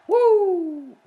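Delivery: high, loud wrestling woo; shrill showman's woo; Flair woo yell. Pretend. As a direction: let out a ring-style woo.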